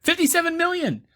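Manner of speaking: very high-pitched squeak of disbelief; high, incredulous insistence; incredulous emphasis. Real, not acted. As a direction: throw the figure back way up high. Stressing the big gross incredulously.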